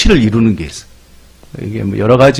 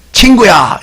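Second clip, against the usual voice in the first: hearty, higher Gyeongsang shout of "friend"; Gyeongsang dialect call; 7 semitones higher, 3 dB louder, slower than usual. Retold or imitated. Preacher voicing a Gyeongsang friend's hearty call. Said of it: "갱상도에는".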